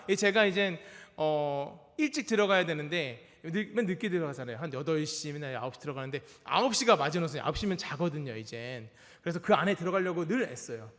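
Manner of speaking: normal talking voice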